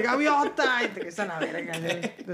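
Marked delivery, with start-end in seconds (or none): 0.0-0.8 high, loud telenovela cry of a name; gasping soap opera drama. Acted. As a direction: wail the name like a soap heroine. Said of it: "es así como de telenovela".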